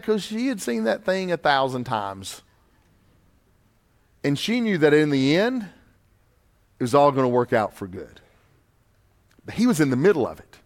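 ordinary talk, nothing marked

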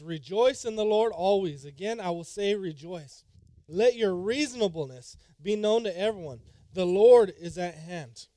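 loud, ringing scripture reading; preaching scripture. Acted. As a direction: proclaim the verse loudly.

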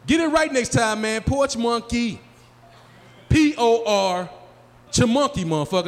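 high, indignant scolding; mad friend. Acted. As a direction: correct him crossly, spelling it out slowly. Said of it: "he'll get mad you call him".

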